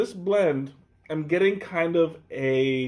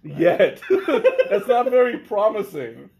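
ordinary talk, nothing marked

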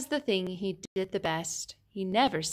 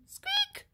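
sudden high squeaky peep; tiny squeak; 6 dB quieter than usual. Acted. Allosaur trying to roar, a tiny high squeak. Dinosaur tries to sing along.

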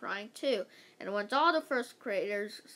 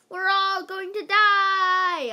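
high, very loud, stretched-out doom cry; shouting; 9 semitones higher, 11 dB louder, slower than usual. A narrator's reading. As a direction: shout in hammed-up panic. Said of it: "I shouted".

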